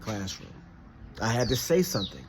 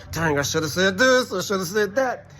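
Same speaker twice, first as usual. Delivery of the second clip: exasperated self-reproach, higher and louder; regretful; 7 semitones higher, 4 dB louder than usual. Retold or imitated. Kicking himself after losing an argument, exasperated. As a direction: kick yourself out loud after the fact.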